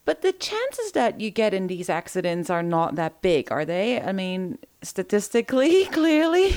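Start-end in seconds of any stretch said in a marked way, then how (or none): 4.8-6.5 laughing. Real, not acted. Teasing, laughing.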